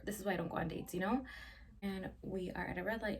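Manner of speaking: ordinary delivery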